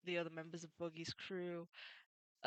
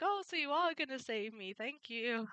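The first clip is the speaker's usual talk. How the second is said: higher, eager thanks; grateful Luffy; 6 semitones higher, 3 dB louder than usual. Retold or imitated. Luffy thanks Nami for saving him, higher and eager. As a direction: beam with relief at being saved. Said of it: "And Luffy's like".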